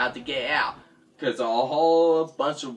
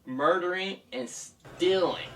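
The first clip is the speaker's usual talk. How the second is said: ordinary delivery, nothing marked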